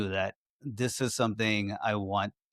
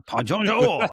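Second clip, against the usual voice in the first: hammed-up, higher French accent, drawn out; bad French accent; 10 semitones higher, 4 dB louder than usual. Man joking to friends about France, bad French accent. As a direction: put on a terrible French accent, slowly. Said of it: "Worst French accent".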